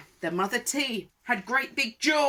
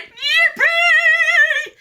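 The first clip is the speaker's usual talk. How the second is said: very high, loud whoop; long falsetto cheer; gleeful cry; 16 dB louder than usual. A narrator's reading. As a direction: whoop it out in triumph. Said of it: "the T's cried".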